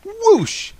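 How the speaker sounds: high-pitched spoken whoosh effect; imitated whoosh. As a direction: make a big rushing swoosh. Imitates the miracle's sound effect.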